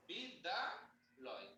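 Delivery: বিদ্যালয় split slowly into বিদ, দা, লয়; syllable by syllable. Shown as a demonstration. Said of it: "এই বিদ বলার পরে এর বেশি কি বলতে পারছি?". (ধীরে ধীরে অক্ষর ভেঙে বলা)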